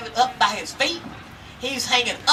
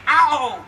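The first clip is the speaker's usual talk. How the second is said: sharp higher yelp of pain; 5 semitones higher, 6 dB louder than usual. Acted. Bear bumping his head in the snare, sharp pained ow. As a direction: yelp as the head hits the wood.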